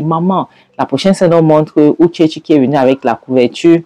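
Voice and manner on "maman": usual speaking voice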